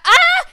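loud, high "ah!"; sudden yelp of fright; startled scream. Real, not acted. Gamer opening a door and getting scared, startled scream.

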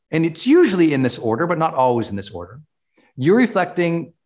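ordinary talk, nothing marked

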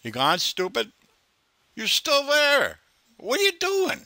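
mock him in a high silly voice.

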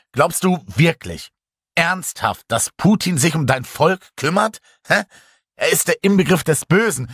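heated confrontation. A narrator's reading. Narrator confronts Boris heatedly.

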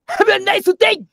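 very high, very loud English shout; full-force cheerful yell; all-out cheery sign-off. Acted. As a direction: put every ounce into it. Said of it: "渾身の力振り絞んのやめて".

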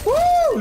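ouh très aigu et fort; un hululement de surprise; cri aigu. Real, not acted. Cri en jeu. (cri aigu et fort)

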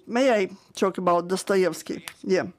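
normal talking voice

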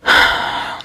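sigh, unsure what to do; cat sighing, wondering who could help. A narrator's reading. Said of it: "Meg sighed".

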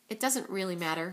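usual speaking voice

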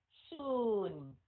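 "Soon" with a long oo, falling into N; drawn-out vowel. Shown as a demonstration. Teacher modelling double vowels, long falling "Soon". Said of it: "hai cái nguyên âm thì mình kéo dài".

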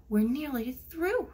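usual speaking voice

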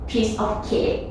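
ordinary talk, nothing marked

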